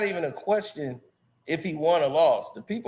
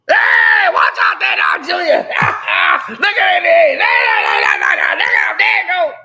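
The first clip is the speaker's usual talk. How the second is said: put-on loud hollering old-timer greeting; old folks greeting; 9 semitones higher, 11 dB louder than usual. Old folks greeting family at the door, hollering. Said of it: "that's just how our folks talk".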